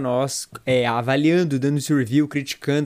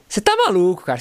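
staged excited phrase; 4 semitones higher than usual. Shown as a demonstration. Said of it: "Vou falar umas frases aqui".

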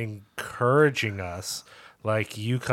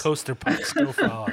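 laughing plug; 11 semitones higher than usual. Real, not acted. Plugging with a laugh.

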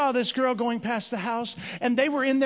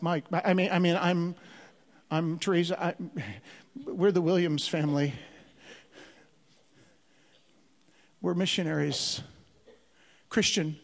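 flustered; 5 semitones lower than usual. Retold or imitated. Flustered introduction to a prostitute, retold.

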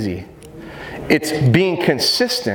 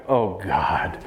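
dog's thought; 8 semitones lower, 4 dB quieter than usual. Retold or imitated. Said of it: "she went".

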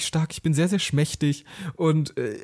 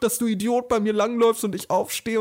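hammed-up tirade, pitch pushed up, volume barely raised; retold yelling; 6 semitones higher than usual. A man retells yelling at the bar guy over spilled drinks, mock yelling. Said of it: "ich noch weiter angeschrien".

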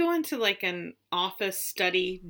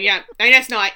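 loud accusing voice; 10 dB louder than usual. Acted. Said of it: "And she's like".

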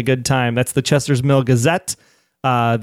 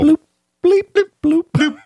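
bloop; 14 semitones higher than usual. Acted. Mocking on-screen text beeps.

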